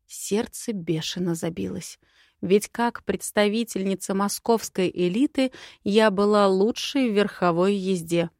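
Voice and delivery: ordinary delivery, nothing marked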